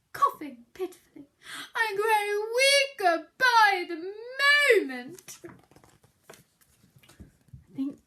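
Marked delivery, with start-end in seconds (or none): 1.8-5.4 high, louder theatrical whimper. Acted. Bear moaning to Mouse, theatrical whimper. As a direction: whine weakly for sympathy.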